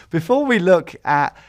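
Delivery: talking through a loud laugh; laughing through words. Real, not acted. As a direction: let the laugh spill into the words. Laughing at own list.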